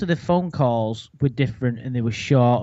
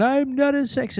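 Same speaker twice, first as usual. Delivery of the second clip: a higher mock denial; mock Lorne; 10 semitones higher than usual. Summing up Lorne's call, quick higher mock denial. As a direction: toss off his line as a quote. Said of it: "he babbled on".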